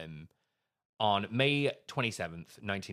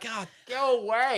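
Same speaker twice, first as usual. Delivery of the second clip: exasperated; 14 semitones higher, 6 dB louder, slower than usual. Pretend. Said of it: "But I'd be like".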